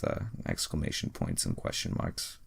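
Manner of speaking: ordinary delivery, nothing marked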